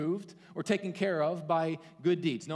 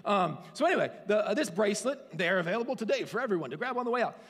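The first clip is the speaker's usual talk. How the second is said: louder, higher, fast sales pitch; infomercial voice; 5 semitones higher, 7 dB louder, faster than usual. Pretend. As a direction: pitch it like a TV ad host. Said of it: "that's my infomercial".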